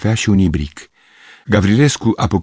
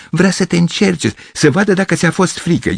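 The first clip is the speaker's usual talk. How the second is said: put-on high, laughing girl's voice; mock him like a giggling girl; 12 semitones higher than usual. Girl laughing at Gavrilescu, high and mocking. Two girls teasing.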